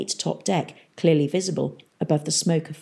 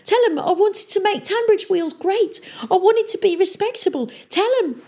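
high-pitched desperate pleading; whimpering. A narrator's reading. Sinking mayor begging Tom to speak up, high whimper. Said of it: "he whimpered".

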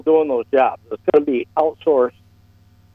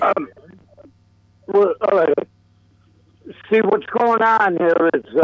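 clipped phone line. Real, not acted. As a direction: talk through a broken phone connection. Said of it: "I can't do it".

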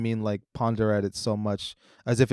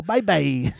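Trump impression; 6 semitones higher, 5 dB louder than usual. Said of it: "they go".

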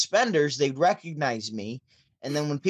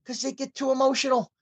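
higher, put-on comedian voice; comedian's punchline voice; 11 semitones higher than usual. Comedian on stage ribbing the crowd, higher put-on voice. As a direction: land the joke like the comedian on stage. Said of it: "He goes".

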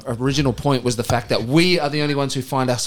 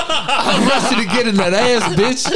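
laughing; 8 semitones higher, slower than usual. Real, not acted. Joke delivery, laughing.

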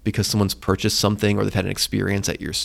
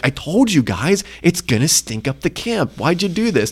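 higher-pitched mock exasperated telling-off; angry scolding; 7 semitones higher than usual. Moses angry with the people who didn't listen, exasperated. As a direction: scold them in mild mock anger. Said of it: "he was angry with them".

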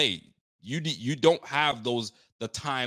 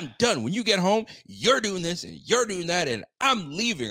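higher, exasperated imitation; lightly voiced fed-up wife laying down orders; mock fed-up wife; 7 semitones higher than usual. Husband quoting wife's meltdown, mildly exasperated higher voice. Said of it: "It's like".

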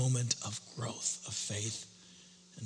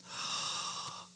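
shocked gasp; 9 dB quieter than usual. Retold or imitated. Hearing the nurse say Jim died.

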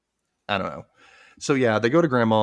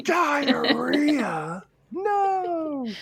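very high, drawn-out cry without real volume; imitated dramatic yell; mock yelling; much slower than usual. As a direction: wail it in exaggerated horror. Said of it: "She yelled it".

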